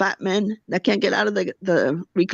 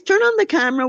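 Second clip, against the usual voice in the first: shrill-free high mock of someone staging tears; high mocking call to start filming; 9 semitones higher, faster than usual. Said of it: "the way she cries".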